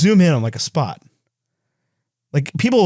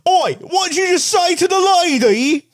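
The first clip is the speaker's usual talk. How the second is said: much higher, cockney Oi shout; Matt Smith cockney. Retold or imitated. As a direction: bark it like a London tough. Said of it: "he turns around ... and goes".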